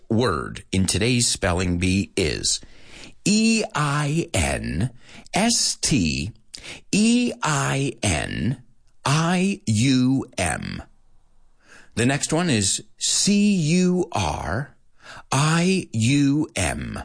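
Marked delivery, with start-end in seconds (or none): 3.2-10.8 slow, spaced letters with pauses; slow spelling. Shown as a demonstration. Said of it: "at a more human pace". 13.0-17.0 slow, spaced-out letters with a pause after each; slow spelling. Shown as a demonstration. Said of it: "at a more human pace".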